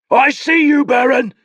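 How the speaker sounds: high, loud taunting shout; gloating shout. Acted. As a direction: jeer at him across the water. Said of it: "Nabor shouted".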